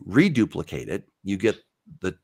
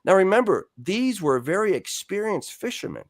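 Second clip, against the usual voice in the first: usual speaking voice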